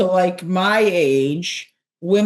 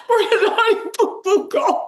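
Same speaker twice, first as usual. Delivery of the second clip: silly high voice; 13 semitones higher than usual. Pretend. Joking her shoes are too posh, high laughing squeak. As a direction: giggle the whole line out in a high cartoon squeak. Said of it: "Now we're just getting overly silly".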